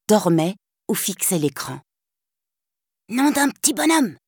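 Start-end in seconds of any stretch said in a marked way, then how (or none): 3.1-4.2 Tips whispering. Acted. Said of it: "chuchota Tips".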